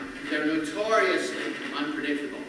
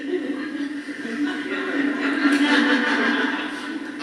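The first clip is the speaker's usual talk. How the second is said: long, high breathy laugh; big laugh building louder; 9 semitones higher, 4 dB louder than usual. Real, not acted. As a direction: laugh hard at your own joke. Laughs at own Scottish impression.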